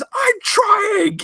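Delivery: high mock cry of effort; mock strained shout. Leia straining in the trash compactor, high mock yell. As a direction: yelp it like she's straining.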